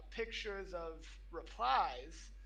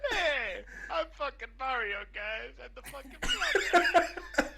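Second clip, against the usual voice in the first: higher, louder hammed-up Italian plumber; Mario impression; 7 semitones higher, 12 dB louder, slower than usual. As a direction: ham up a cartoon Italian plumber. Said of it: "S1 also does Mario".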